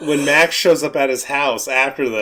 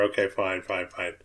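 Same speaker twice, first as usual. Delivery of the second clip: fading, much softer; mock muttered fine; 7 semitones lower, 11 dB quieter than usual. Lewis giving up on explaining, fading softer. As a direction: let it die away dismissively. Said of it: "he's like".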